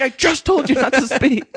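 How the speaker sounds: loud, high-pitched mock scolding; exasperated cop impression. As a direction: yell it like a cop at the end of his patience.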